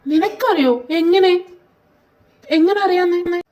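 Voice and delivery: high, loud disbelief; astonished questioning. Acted. Friend stunned that the other already knows, astonished. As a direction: demand to know, voice jumping up. Startled friend demands how they know.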